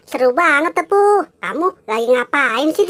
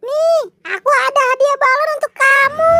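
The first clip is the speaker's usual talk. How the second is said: high comforting figure; 10 semitones higher than usual. Acted. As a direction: soothe a sad friend in a squeaky voice. Comforting sad friend. (suara kartun tinggi menghibur)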